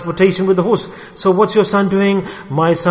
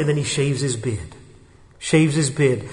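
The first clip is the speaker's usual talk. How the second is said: usual speaking voice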